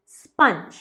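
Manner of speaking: स्पंज as "spunj"; short "uh" vowel in स्पंज; correct pronunciation. Shown as a demonstration. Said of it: "ये है स्पंज". (स्पंज में छोटा "अ" बोलें)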